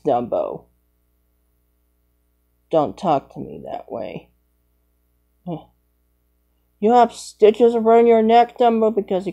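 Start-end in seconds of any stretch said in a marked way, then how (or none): 0.1-4.3 lower, softer pretend character voice; a gruff little toy grumbling low. A child voicing a toy scolding Dumbo, low and soft.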